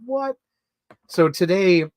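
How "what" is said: high startled squeak; shocked what. Retold or imitated. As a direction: hit it like sudden news. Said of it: "And she's like".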